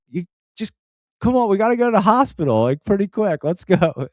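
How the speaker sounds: high, hurried urging; retold urgent pleading. Husband urging his wife to the hospital, high and urgent. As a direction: hurry someone along, re-enacted.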